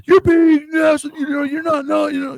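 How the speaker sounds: mock shout. Mimicking someone lashing out.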